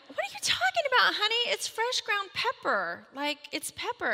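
a sing-song mother calming her daughter; sugary mom voice. Retold or imitated. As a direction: coo at the child as if nothing is wrong. Mom soothing the crying child.

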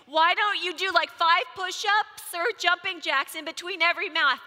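loud, high yell at her son; mother yelling exercise orders during math. Retold or imitated. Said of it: "now I'm yelling at him".

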